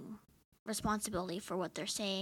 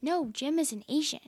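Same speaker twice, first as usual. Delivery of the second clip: insistent Dwight; 6 semitones higher, 5 dB louder than usual. Retold or imitated. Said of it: "he's like".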